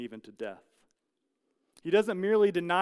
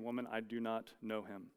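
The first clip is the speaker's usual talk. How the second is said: low, much softer, hushed denial; 6 semitones lower, 11 dB quieter than usual. Retold or imitated. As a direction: deny it under your breath, afraid to be heard. Peter denies Jesus quietly.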